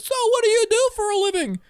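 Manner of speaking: high, loud shouting over the noise; mock shout across table. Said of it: "someone will be like".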